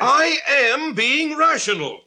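high, slow, emphatic anger; indignant, heated outburst. Acted. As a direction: insist loudly, drawing out each word. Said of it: "Then stop raising your voice".

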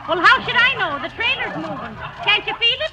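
high, loud exasperated panic; agitated alarm. Acted. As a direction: throw it back at him urgently. Wife panics, exasperated with husband.

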